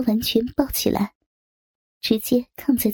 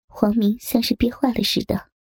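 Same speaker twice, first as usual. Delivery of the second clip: usual speaking voice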